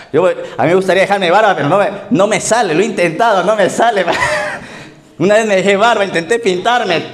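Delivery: can't stop giggling about the beard; laughing. Real, not acted. Laughing about his failed beard. (riéndose de sí mismo, más agudo y fuerte)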